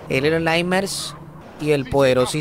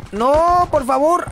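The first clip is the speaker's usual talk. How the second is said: high, loud dismayed cry; alarmed plea; 10 semitones higher, 5 dB louder, slower than usual. Real, not acted. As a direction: beg the game not to do it. Algo le sale mal en el juego. (súplica alarmada, aguda y fuerte)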